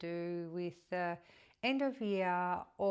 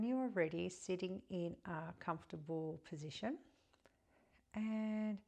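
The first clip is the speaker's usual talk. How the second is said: softer, calm guiding voice, phrase by phrase; guided meditation; 2 semitones higher, 6 dB quieter than usual. Acted. Guiding listeners through breath and a pier visualization, meditative.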